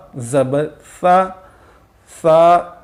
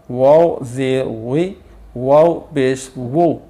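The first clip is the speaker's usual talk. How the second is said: ordinary talk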